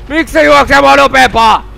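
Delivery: loud, high, desperate question; panicked yell. Real, not acted. Player outrun by an enemy, panicked high yell. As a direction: yell it as you flee.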